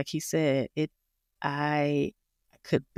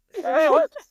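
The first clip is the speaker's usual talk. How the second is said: high burst of laughter; a high, loud burst of laughter; 13 semitones higher, 7 dB louder than usual. Real, not acted. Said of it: "laughs at kicking parents out".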